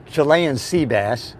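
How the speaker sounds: ordinary talk, nothing marked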